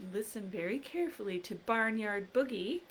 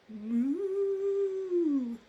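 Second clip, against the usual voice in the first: cow moo; 7 semitones higher, 3 dB louder than usual. Acted. Cow starts band.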